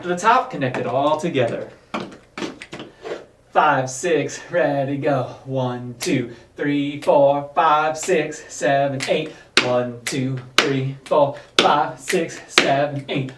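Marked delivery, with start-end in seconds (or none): 3.6-13.3 low, steady counted beat; counting dance beats. Shown as a demonstration. Counting beats, lower register.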